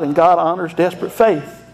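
normal talking voice

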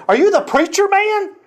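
put-on high, eager old man asking; old man's surprised question. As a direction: lift it way up with hope.

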